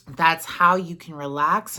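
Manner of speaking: ordinary talk, nothing marked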